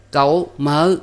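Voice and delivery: high, loud call; girl calling out. A narrator's reading. Niece outside calling to her uncle, loud call. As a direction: call to your uncle from a distance. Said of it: "Nghe tiếng con gái ngoài xe kêu".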